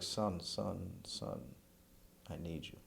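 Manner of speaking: softly retold mother's plea; hushed, as a mother needing her son; quiet plea. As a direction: imitate a mom pleading, voice falling away. Mom's plea, retold in emotional story.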